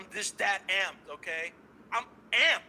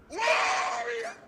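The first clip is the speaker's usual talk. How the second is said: powered-up shout; 13 semitones higher, much slower than usual. Acted. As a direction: bellow it like an anime hero fully charged. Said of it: "Super Saiyan charge up for this one".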